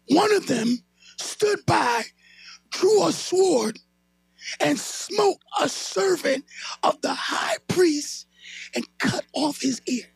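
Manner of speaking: slow, drawn-out storytelling; slow dramatic telling. Acted. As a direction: stretch each phrase for drama. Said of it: "Now watch this".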